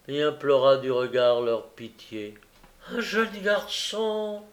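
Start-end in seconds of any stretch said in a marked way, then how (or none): 2.9-4.4 high, slow cry of surprise without extra volume; astonished exclamation. A narrator's reading. Gilles discovering the boy at the door, astonished. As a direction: exclaim it slowly, pitched up. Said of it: "s'écria monsieur Gilles".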